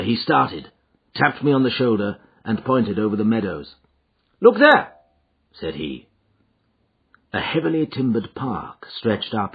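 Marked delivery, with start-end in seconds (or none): 4.4-4.9 high, louder exclamation; urgent, raised-pitch alert; sudden pointing-out. A narrator's reading. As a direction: call attention to it abruptly. Holmes suddenly points out the house.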